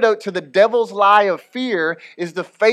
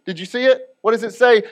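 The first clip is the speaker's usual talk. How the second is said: ordinary talk, nothing marked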